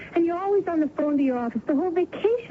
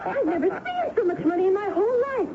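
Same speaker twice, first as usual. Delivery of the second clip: excited; 6 semitones higher than usual. Acted. Helena overwhelmed after winning at roulette. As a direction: laugh through it, overwhelmed by the win.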